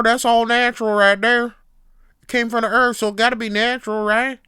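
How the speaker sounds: loud, high Southern rant; Southern hillbilly accent. Acted. Hillbilly insisting burning fuel is natural, shouted Southern accent. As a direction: rattle on at a holler as a Southern buffoon. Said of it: "Southern hillbilly being a buffoon".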